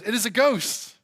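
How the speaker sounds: mock-frightened outcry in a scripture reading; fearful cry. A narrator's reading. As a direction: read it as a startled cry. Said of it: "they cried out in fear".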